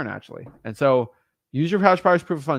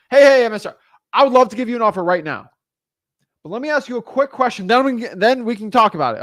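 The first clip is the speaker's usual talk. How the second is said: confident caller; 7 semitones higher, 7 dB louder than usual. Retold or imitated. Caller answers the pushy seller.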